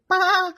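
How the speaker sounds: loud, high mock "bah"; sheep bleat. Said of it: "I practiced that joke about the sheep".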